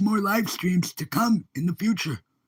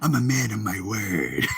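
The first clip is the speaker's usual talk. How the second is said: low, drawn villain line; put-on villain voice, pitched well down; 11 semitones lower than usual. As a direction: drop low like a menacing film villain. Joker-style quote, then cackle.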